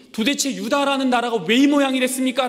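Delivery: high, loud exasperated complaint; the prophet venting at God, loud and high; complaining cry. Retold or imitated. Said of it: "막 불평을 터치리며".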